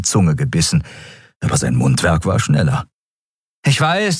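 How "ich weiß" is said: put-on much higher, hurried boy's voice; a boy bluffing too fast; nervous young Miko. Miko bluffing to the captain, high and nervous. Miko admits his looks.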